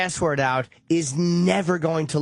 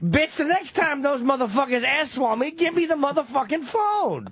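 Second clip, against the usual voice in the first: higher, brash barking demand; mock tough demand; 7 semitones higher than usual. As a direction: boss around the house, cursing. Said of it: "walk in the living room and say".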